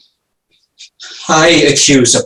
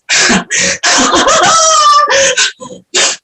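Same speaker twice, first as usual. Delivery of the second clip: mad sobbing laugh. Acted.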